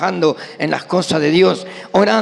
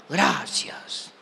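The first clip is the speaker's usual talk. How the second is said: much softer, breathless whispered "Gracias"; whispered thanks; 4 semitones lower, 9 dB quieter than usual. Retold or imitated. Cashier thanking the honest customer, whispered. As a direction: whisper it, sheepish and dumbstruck.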